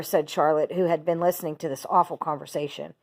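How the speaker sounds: usual speaking voice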